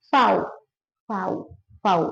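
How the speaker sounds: Vau said "fow", starting with f; name the German letter as Vau, rhyming with cow. Shown as a demonstration. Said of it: "bahasa Jerman ngomongnya". (Vau dibaca "fau" ala Jerman)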